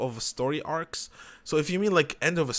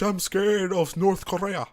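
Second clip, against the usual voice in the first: higher, slower, put-on movie voice; movie quote voice; 6 semitones higher, slower than usual. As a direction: quote it like a scared film character. Quoting a movie line.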